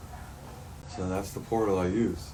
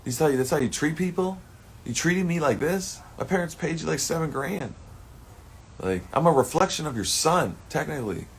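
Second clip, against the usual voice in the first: indignant rant; 8 semitones higher, 4 dB louder than usual. Retold or imitated. Confronting the woman, retold.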